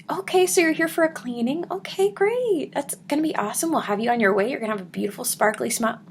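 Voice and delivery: high, bright and bouncy patient welcome; lively hygienist. Retold or imitated. Hygienist welcoming a patient to her cleaning, high and cheery. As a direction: sell the cleaning with sunny enthusiasm. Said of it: "Lively as could be".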